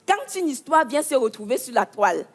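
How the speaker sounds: ordinary talk, nothing marked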